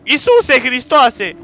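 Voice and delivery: slow, loud, high cry of shock; shocked yell; startled exclamation. Real, not acted. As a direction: stretch it out in alarm. Jump scare in game.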